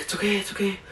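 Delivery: soft, hushed reassurance; quiet whispered-ish comfort to oneself; soothing self-talk. Retold or imitated. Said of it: "just be like".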